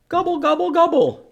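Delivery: high, loud gobbling call; turkey gobble. Shown as a demonstration. Teacher showing children the turkey sound, loud high gobble. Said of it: "What sound do they make?".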